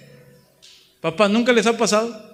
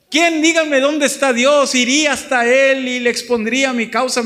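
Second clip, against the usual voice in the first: defiant Job; 7 semitones higher, 6 dB louder than usual. Retold or imitated. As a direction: dare anyone to show him God. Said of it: "hasta dijo". (Job retador, lento, agudo y fuerte)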